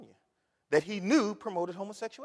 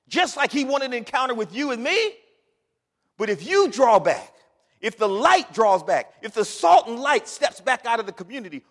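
loud, high impassioned plea; preaching at full force; 8 semitones higher, 11 dB louder than usual. Acted. Preacher pleading with saints about the lost, loud and high. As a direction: build to a shouting plea.

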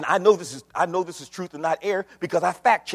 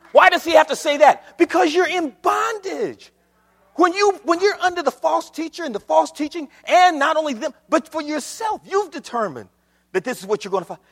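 high, forceful pulpit delivery; fired-up preacher driving the point home; impassioned preaching; 11 semitones higher, 6 dB louder than usual. Acted. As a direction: preach it out, pitch held high.

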